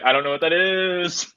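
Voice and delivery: high, rising mock wail; falling wail. Darcy replying as she falls, high comic wail. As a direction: call it out while plummeting.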